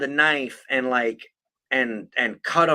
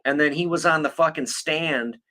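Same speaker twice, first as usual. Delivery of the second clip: ordinary talk, nothing marked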